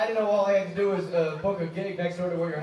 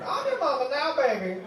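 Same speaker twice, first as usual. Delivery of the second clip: high, doting mama voice; Big Mama; 6 semitones higher, 3 dB quieter than usual. Acted. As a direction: sweet-talk him like a big mama. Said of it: "Big Mama Got It All".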